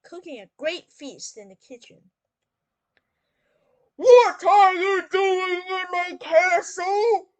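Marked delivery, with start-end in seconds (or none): 4.0-7.3 slow, high, very loud bellow; roaring giant. Acted. Giant confronting the cat in his castle, slow thundering roar. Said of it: "roared the giant".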